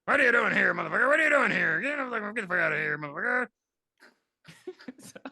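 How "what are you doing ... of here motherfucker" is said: louder, rough guard voice, chasing him off; angry guard. Retold or imitated. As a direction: bark at the intruder to get out. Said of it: "But then here come".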